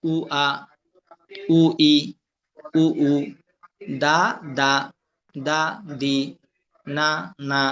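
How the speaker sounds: each syllable, from "উ আ" to "না না", held apart by pauses; one sound at a time. Shown as a demonstration. Teacher showing students to read each sound, "দা … দি" separately. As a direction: sound out "দা", "দি", "না" singly, evenly. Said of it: "একটা একটা উচ্চারণ দেখবেন".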